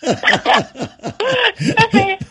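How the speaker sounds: drawn out through a real laugh, high; laughing agreement. Real, not acted. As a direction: laugh the words out slowly. Guest laughs along with host.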